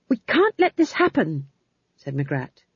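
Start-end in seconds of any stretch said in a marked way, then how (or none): 0.1-1.5 high, urgent protest; urgent objection. A narrator's reading. Young witch insisting they act, urgent high protest. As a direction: cut in urgently, voice pitched up. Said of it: "quickly and loudly".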